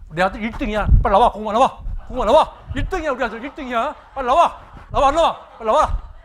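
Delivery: a proud dad yelling across the village; proud father shouting. Retold or imitated. Father calls neighbors out to brag his son is first, shouting.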